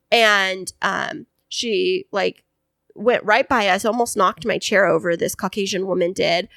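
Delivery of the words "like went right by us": normal talking voice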